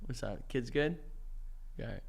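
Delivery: put-on low, quiet, menacing drunk; suspicious tipsy husband. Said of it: "I'm a little drunk".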